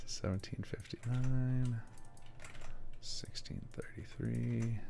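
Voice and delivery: slow, soft ASMR numbers. Shown as a demonstration. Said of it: "Anybody getting tingly?".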